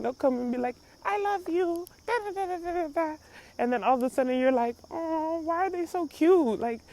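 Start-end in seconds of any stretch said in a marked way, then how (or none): 1.0-3.1 put-on high, soft kid making up; sweet little kid. Child hugging you after misbehaving, sweet and high. 4.9-6.6 high, softened parent coo; cooing adoration. Retold or imitated. Parent won over by a naughty kid, cooing. Adult melting at cute child.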